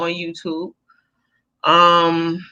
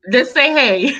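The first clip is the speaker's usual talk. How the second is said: laughing; 7 semitones higher than usual. Real, not acted.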